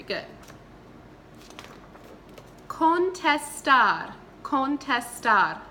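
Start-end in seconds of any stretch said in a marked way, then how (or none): 2.7-5.6 Contestar spoken slowly and louder; slow model word. Shown as a demonstration. Teacher drilling a verb for students to repeat.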